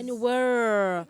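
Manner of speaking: host says "Anywhere" slowly with a rolled r; imitating Russian r. Shown as a demonstration. Host practising the Russian r, slowly. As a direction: draw out "Anywhere" rolling the r. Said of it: "So the R is R".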